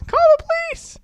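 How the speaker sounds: very high, squealed mock cry; theatrical falsetto cry for help; acted scream. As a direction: shriek it in falsetto. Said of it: "She screams".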